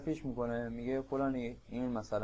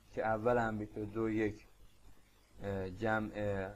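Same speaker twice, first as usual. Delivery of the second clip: ordinary delivery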